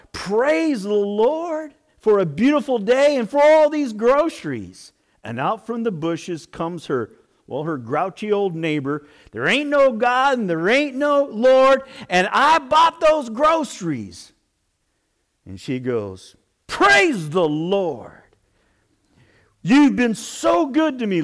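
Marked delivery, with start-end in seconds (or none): 0.1-4.9 high, loud delighted praise; shouting out praise. Retold or imitated. Lady finding groceries on her porch, loud delighted praise. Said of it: "She sells out". 9.3-14.3 high, loud gotcha shout; spring out of the bushes yelling, much higher and louder; triumphant yell. Retold or imitated. Neighbor jumping out of the bushes, loud smug yell. 16.7-21.2 joyful praise. Retold or imitated. Lady answers neighbor with praise.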